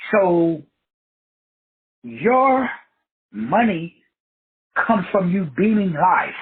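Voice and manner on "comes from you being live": ordinary talk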